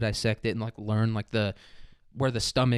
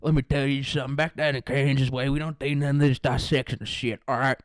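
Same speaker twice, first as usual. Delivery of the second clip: put-on backwoods country accent; 2 semitones higher than usual. Explaining catfish skinning to lab partner, thick country accent. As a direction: talk like a country fisherman holding forth. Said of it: "I just started talking like that".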